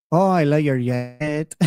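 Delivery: lightly imitated Colombian accent; hint of a Colombian woman's accent. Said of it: "her accent. She sounded like".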